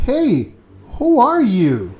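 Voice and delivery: loud, startled question, pitch up; surprised. Retold or imitated. Chris startled that a girl talks to him, loud surprised question. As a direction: blurt it out like he can't believe she's talking to him.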